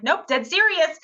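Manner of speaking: high, emphatic insistence; convincing the desk clerk, high and emphatic; insistent. Retold or imitated. Cindy insisting to the gym clerk, emphatic.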